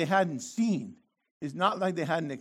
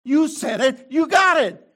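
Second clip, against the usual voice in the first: loud, raised "you got it", half shouted; God's stern voice; 7 semitones higher, 8 dB louder than usual. Acted. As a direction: hand down the verdict loudly. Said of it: "and the Lord said".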